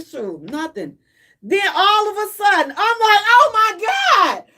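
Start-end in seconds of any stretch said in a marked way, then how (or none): 0.0-1.0 unimpressed mutter. Retold or imitated. Gummy not working yet. 2.8-4.4 higher, louder, slow drawn-out alarm; the gummy just kicked in, dragging out the panic; sudden panic. Retold or imitated. Gummy suddenly hits her.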